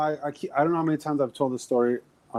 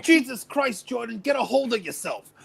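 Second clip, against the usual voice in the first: movie lawyer voice; 7 semitones higher than usual. Retold or imitated. Lawyer voice from the film.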